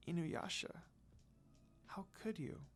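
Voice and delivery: broken, unvoiced whisper of hurt; heartbroken whisper. Kagome watching Inuyasha kiss Kikyo, a tearful whisper. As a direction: breathe it out as tears start.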